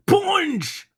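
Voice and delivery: high, loud attack yell; battle shout. Acted. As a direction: shout the hit. Villain shouts his attack.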